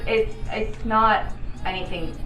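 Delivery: ordinary talk, nothing marked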